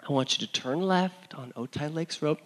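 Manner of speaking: lightly voiced, soft patient mother; quietly guiding directions, imitating mom; soothing, hushed instructions; calm mom. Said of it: "my mom was like".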